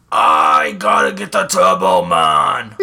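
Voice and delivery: loud, drawn-out Schwarzenegger impression with a terrible accent; over-the-top, badly done Arnold accent shout. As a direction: bellow it slowly in a hammed-up Arnold accent. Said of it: "is that the worst accent in the world".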